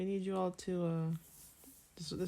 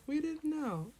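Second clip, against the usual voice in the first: higher-pitched excuse, lightly acted; mock protest; 10 semitones higher, slower than usual. Co-host imagining their excuse after the fact, mock protest. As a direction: plead innocence in a raised pitch. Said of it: "we're like".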